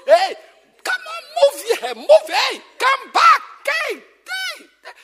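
put-on calling out children. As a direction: shout the children back into place. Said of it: "I use half of the time to correct behavior".

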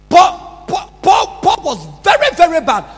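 high, loud shouted emphasis; emphatic preaching. Real, not acted. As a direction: hammer the point home to the congregation. Preaching at full force.

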